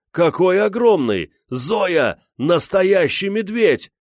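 put-on very high, loud boyish amazement; excited shouting. A narrator's reading. Гриша calls his sister to see the bear, shouting. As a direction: cry out high, stretching the last words. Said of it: "закричал Гриша".

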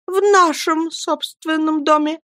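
high, timid wifely voice; frightened correction. A narrator's reading. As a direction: hastily back down in a high, meek voice. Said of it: "пробормотала, видимо, перепуганная бедняжка-мамочка". (испуганно, высоким голосом)

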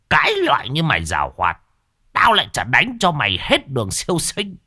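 stamp and scold the demon with fury; furious scolding. Acted. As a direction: exorcist master shouting curses at the demon. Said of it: "dậm chân bành bạch mà quát".

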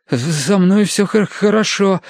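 high, slow, stammering with cold; shivering. A narrator's reading. Terence in the water insists he is fine, shivering. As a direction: stutter it out through shivers. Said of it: "ответил он дрожа".

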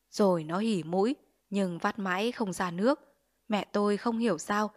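ordinary delivery, nothing marked

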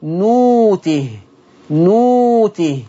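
نوته slow and long, hamza turned to waw; Warsh reading. Shown as a demonstration. Teacher reciting the word for students, slowly stretched. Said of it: "تقرأ نوته".

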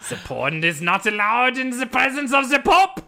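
high, hammed-up German proclamation; mock German accent. Vatican welcome before the Pope, mock German accent. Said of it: "that was some kind of German".

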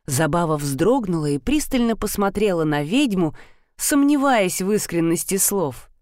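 ordinary delivery

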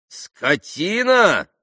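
high, drawn-out indignant echo; indignant retort. A narrator's reading. Landlord echoes the pan's insult, indignant. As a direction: throw the insult back as an outraged question.

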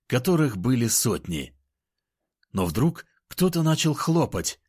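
plain reading voice

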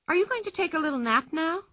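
high, quick, sweetly polite question; Pippi, polite asking. A narrator's reading. Pippi to the tired bull, politely inquiring. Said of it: "asked Pippi politely".

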